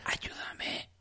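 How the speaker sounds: urgent whisper, slowing on the last plea. Acted. Lucy pleads with Harry in a hushed whisper. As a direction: whisper for help from the dark trees. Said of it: "con un susurro".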